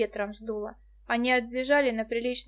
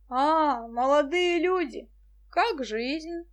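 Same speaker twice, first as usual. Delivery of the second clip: tipsy professor; 5 semitones higher, 3 dB louder, much slower than usual. A narrator's reading. Drunk professor greets kids.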